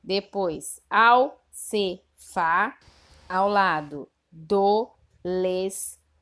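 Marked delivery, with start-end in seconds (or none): 0.9-2.8 very slow and loud, each syllable of acarçu drawn out; teacher dictating the scrambled word acarçu very slowly; slow syllable reading. Shown as a demonstration. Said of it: "Eu vou ler do jeito que está no livro". 4.3-6.1 very slow and loud, each syllable of acarçu drawn out; teacher dictating the scrambled word acarçu very slowly; slow syllable reading. Shown as a demonstration. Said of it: "Eu vou ler do jeito que está no livro".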